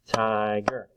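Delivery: "tiger" in two drawn-out, level beats; split into syllables. Shown as a demonstration. Teacher clapping syllables for children, "tiger" split in two. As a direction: sound out "tiger" part by part. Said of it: "Let's do it".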